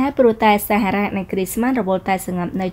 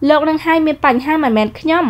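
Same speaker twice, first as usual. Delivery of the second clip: higher, sharp retort from a proud heroine; haughty young woman; 7 semitones higher, 3 dB louder than usual. Acted. Heroine snapping back at the rude man, higher pitched. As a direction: toss it back at him, offended.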